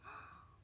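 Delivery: susurro sorprendido. Acted. Descubre el azul.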